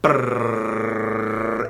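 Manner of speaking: rolled r drum roll. Acted. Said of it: "how good you are at doing a drum roll".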